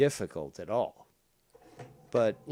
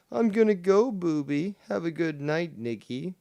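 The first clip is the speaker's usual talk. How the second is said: higher, louder sing-song goodbye; imitating Travis; 6 semitones higher, 5 dB louder than usual. Streamer reading Travis's goodbye in a high mock voice. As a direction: play a cutesy farewell.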